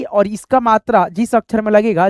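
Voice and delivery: normal talking voice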